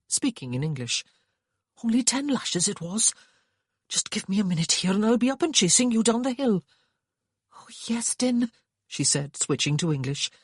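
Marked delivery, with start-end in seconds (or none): 1.8-6.6 lightly voiced young Welsh lad; cheerful despite the pain; injured Welsh boy, brave. A narrator's reading. 7.5-8.5 breathless whisper. A narrator's reading. Mother figure over beaten boy.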